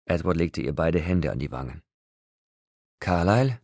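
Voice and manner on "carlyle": higher, tense questioning call. A narrator's reading. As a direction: call out anxiously, voice rising. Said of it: "rief er leise und angespannt". (angespannt gerufen)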